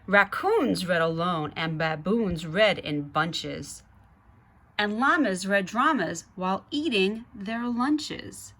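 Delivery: put-on lively read-aloud to children; story narration. A narrator's reading. Reading the Zoobrary book to kids, lively narration. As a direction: read it like a bedtime picture book.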